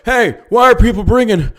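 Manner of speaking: hammed-up ignorant bureaucrat; mocking politician. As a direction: make him sound clueless. Mocking gun-control politician.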